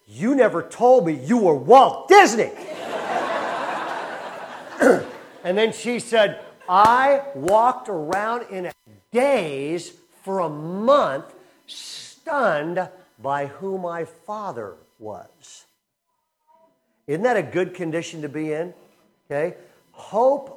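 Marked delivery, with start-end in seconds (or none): 0.1-2.5 loud, high, laughing accusation; little girl's mock indignant outburst; indignant child. Child confronting her father. 2.6-5.4 breathy high laugh; real amused laugh; laughter. Real, not acted. Laughs after punchline. 6.7-15.7 slow, stretched, louder retelling; awed slow narration; dazed, slow. Retold or imitated. Retelling being stunned.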